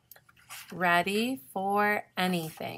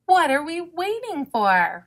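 high, loud eager outburst; bright-eyed storybook enthusiasm, pitched up; excited cry; 10 semitones higher, 6 dB louder than usual. A narrator's reading. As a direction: cry out, raring to go. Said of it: "Raccoon cried".

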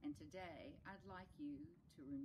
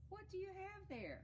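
high-pitched, wondering question; curious character; 3 dB louder than usual. Acted. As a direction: ask it high and wide-eyed like a storybook character.

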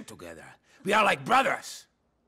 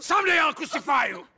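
angry threat; 9 semitones higher, 7 dB louder than usual. Acted. Husband threatens wife in quarrel.

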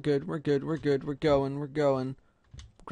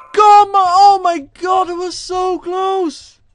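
loud, high yell, dragging out the last words; exasperated yell; 10 semitones higher, 13 dB louder, slower than usual. Real, not acted. Gamer who nearly finished the level, exasperated yell. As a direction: wail at a near miss.

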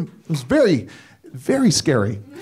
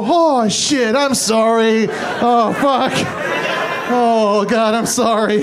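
flustered apology; 3 semitones higher, slower than usual. Retold or imitated. Caught petting, flustered apology.

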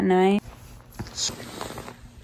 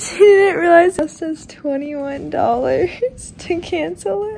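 laughing; 6 semitones higher, 3 dB louder than usual. Real, not acted. Laughing at mom's mistake.

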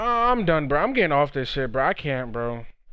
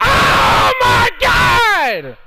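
high, loud, very slow "Oh my God!"; drawn-out outraged shout; 12 semitones higher, 6 dB louder, much slower than usual. Real, not acted. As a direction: stretch it out in exasperation. Outrage at game.

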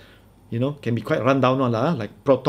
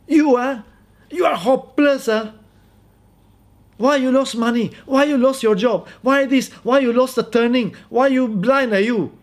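high-pitched mock scolding; nagging wife; 10 semitones higher than usual. Wife scolds husband who screwed up, mock nagging. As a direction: berate him in rapid-fire high complaints. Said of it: "then the husband becomes".

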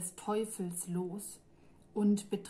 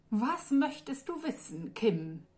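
purring in a squeezed, higher voice; 4 semitones higher than usual. A narrator's reading. Demon purrs his question to frightened Kim, squeezed. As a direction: purr the question through a pinched throat. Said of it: "schnurrte Lefouet gequetscht".

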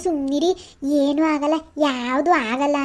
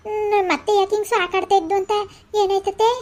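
higher, louder, worried rush of questions; alarmed; 6 semitones higher, 4 dB louder than usual. Acted. Daughter-in-law rushing in, worried, asks the crying mother-in-law. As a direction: burst in asking what happened.

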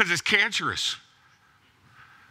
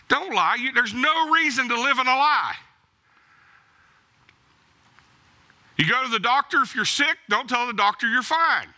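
preaching at full force; 5 semitones higher than usual. Acted. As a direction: drive the point home from the pulpit. Preacher raises voice exhorting congregation.

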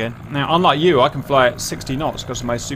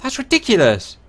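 outraged; 8 semitones higher than usual. Real, not acted. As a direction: complain in disbelief. Outraged at a friend.

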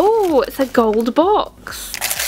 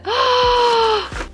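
high gasp; 10 semitones higher than usual. Real, not acted. Opens the last pet.

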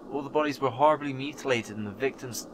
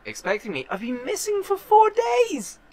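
high, outraged squeak; shocked, rising indignation; indignant disbelief; 3 dB louder than usual. Real, not acted. Indignant at the captain.

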